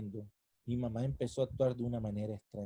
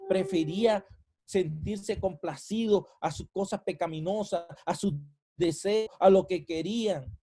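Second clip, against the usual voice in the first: loud, higher sermon voice; preaching; 5 semitones higher, 5 dB louder than usual. Acted. Pastor warning the congregation, loud preaching. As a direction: press it on the congregation. Preaching about Israel's disobedience.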